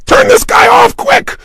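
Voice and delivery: high, loud mock shout; listener's outburst. Bored listener yelling to stop the tape, high mock shout. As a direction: yelp it up high like a fed-up listener. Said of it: "You got".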